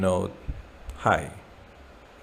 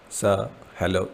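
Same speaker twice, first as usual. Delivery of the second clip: ordinary delivery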